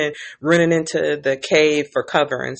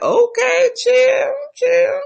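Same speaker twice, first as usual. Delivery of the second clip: squeaky high okay chill chill; shrill-free falsetto telling him to calm down; high-pitched mock plea; 3 dB louder, much slower than usual. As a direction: Corlys backing off in a squeaky voice. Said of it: "And Corlys like".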